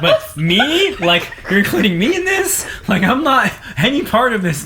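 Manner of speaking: high, loud disbelieving squeal; incredulous. Retold or imitated. Retelling his panic to the host, high incredulous squeal. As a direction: play up the outrage at being dragged in. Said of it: "Like, like".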